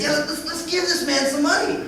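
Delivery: put-on a kid excitedly offering help; child voice. Son urging dad to give to a stranger, high child voice.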